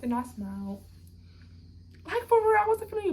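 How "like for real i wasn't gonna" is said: emphatic yelp. Real, not acted. Insisting for real.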